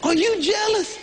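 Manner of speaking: high, rising mock amazement; smug teasing. Husband realizes his wife is jealous, teasing and high. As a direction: savor the flattery. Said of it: "I thought".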